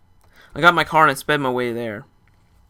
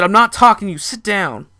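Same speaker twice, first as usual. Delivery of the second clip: higher and much louder, a hard command; angry order; 8 semitones higher, 9 dB louder than usual. Retold or imitated. Narrator angrily orders the ex's friends to sit down. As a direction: shut them down sharply.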